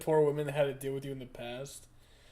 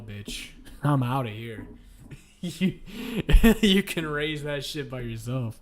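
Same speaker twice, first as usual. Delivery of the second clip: normal talking voice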